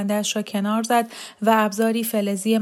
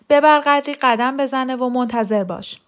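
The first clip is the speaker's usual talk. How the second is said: called out louder and higher; shouting; 4 semitones higher, 5 dB louder than usual. A narrator's reading. Nurse calling to Mariam to walk her around, loud. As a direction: nurse shouting orders over the crowd. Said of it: "پرستار داد زد".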